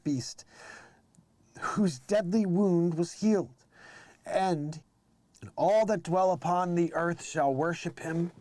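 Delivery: solemn reading from Revelation; scripture reading. Acted. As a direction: read it like King James scripture aloud.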